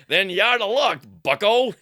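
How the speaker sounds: loud, mock-defiant retort; acted shout back. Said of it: "Porty shouts back".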